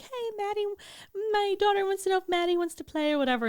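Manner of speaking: high, quick, sing-song mom on the phone; high-pitched imitation of a friend's mother calling; chirpy parent. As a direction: put on a bright other-mother voice, rushing through. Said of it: "a parent called and was like".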